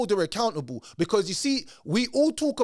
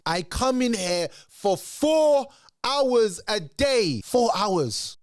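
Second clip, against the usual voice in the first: slow, louder, higher mocking echo; mocking disbelief; 4 semitones higher, 3 dB louder, much slower than usual. Vlogger mocks the four hours claim, slow and sarcastic.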